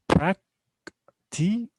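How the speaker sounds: "Practical" very slow, in three separate beats; prac, ti, cal with long gaps in "Practical"; syllable by syllable. Shown as a demonstration. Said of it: "we have three syllables here".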